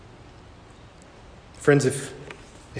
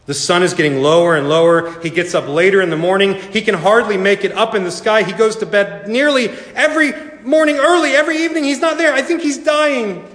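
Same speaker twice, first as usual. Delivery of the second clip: mounting alarm; 7 semitones higher than usual. A narrator's reading. Lily fretting to her sisters over the failing sun, mounting alarm. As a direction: build from worry to high-pitched alarm.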